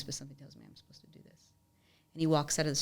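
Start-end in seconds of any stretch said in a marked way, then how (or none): 0.0-1.5 hushed whisper. Retold or imitated. Donor's words repeated.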